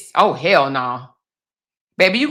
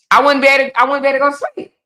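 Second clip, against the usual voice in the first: high, loud protest; no way, say it with everything; emphatic refusal; 9 semitones higher, 4 dB louder, much faster than usual. Real, not acted.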